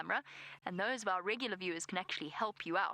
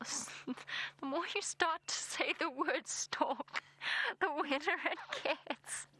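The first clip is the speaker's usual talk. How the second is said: giggly, higher and slowed, words breaking up with laughter; laughing while talking; 7 semitones higher, slower than usual. Real, not acted. Guide to viewers, cracking up over saying stork, laughing. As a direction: laugh through the line about the word.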